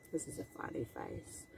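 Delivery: hushed secret whisper. Shown as a demonstration. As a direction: whisper it to the child, quickly.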